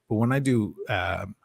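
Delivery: normal talking voice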